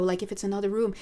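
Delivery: ordinary talk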